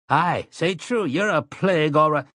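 high, jeering voice; mock him with a high sneer; sneering townsman. A narrator's reading. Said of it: "Took began".

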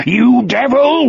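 high, hate-filled cry; anguished scream. A narrator's reading. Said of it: "Wolf screamed".

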